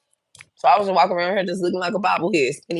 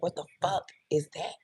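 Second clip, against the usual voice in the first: hushed disbelief; 3 semitones higher, 7 dB quieter than usual. Retold or imitated. Retold shock at the smell.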